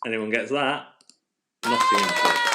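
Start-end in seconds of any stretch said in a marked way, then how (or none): 1.6-2.4 put-on extremely high, loud go at a Nottingham accent; hammed-up, badly faked Nottingham accent; attempted Nottingham accent. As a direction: squeal out a failed Nottingham accent. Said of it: "I don't know how to do Nottingham Forest".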